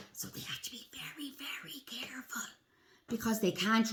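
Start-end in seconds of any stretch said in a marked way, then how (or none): 0.1-2.5 very soft, breath-only whisper; cautionary whisper. Shown as a demonstration. Warning children that predators hunt sloths, hushed careful whisper. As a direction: whisper it as if predators might hear.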